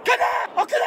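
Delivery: high insult flung at a passing driver; mock road rage. Driver yelling at a truck in mock road rage. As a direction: yell it at the truck in mock fury.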